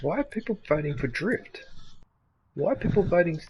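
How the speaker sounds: usual speaking voice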